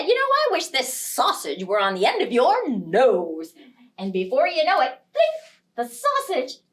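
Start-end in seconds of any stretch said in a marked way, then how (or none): 0.0-3.5 louder fed-up snap; husband snapping at nagging wife, louder; irritated husband. Acted. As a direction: fire back in irritation. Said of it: "a little bit irritated".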